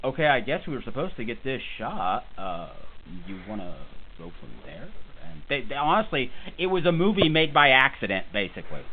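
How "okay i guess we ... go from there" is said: low, trailing, half-hearted crew voice; hesitant and fading, as if guessing; unsure crew member. Retold or imitated. Random crew member unsure about the shot, hesitant. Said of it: "random member of crew would be like".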